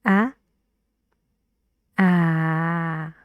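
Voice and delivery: short ah with the jaw open; brief teaching demo of ah. Shown as a demonstration. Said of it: "Is it a long or short sound?".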